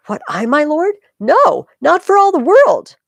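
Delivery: high, loud, slowing protest; horrified refusal. Acted. Clitus to Brutus, a loud horrified refusal. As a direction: recoil from the request.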